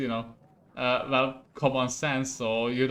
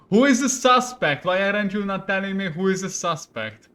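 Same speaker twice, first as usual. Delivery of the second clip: loud, high, impatient questioning; angry demand; 5 semitones higher, 6 dB louder than usual. Acted. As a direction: demand the name, starting at a near shout. King demands the suspect's name.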